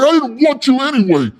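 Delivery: high, loud mock-defiant yell; imitating a sore-loser pitchman; petulant shouted comeback. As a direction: shout off the rejection, voice way up. Rejected pitchman yells back.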